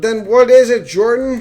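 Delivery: loud, high demand at the screen; heckling the video out loud; mock yelling. Real, not acted. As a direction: shout the question at Jordan. Streamer yelling at the clip.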